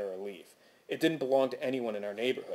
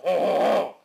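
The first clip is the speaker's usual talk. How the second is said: booming, louder, higher cartoon greeting; cartoon lumberjack; 5 semitones higher, 8 dB louder than usual. Narrator imagines landlord as cartoon axeman greeting people, booming. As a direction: boom it out like a stocky cartoon woodsman. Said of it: "instead of".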